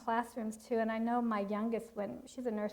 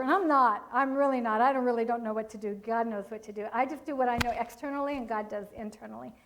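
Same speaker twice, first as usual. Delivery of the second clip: ordinary delivery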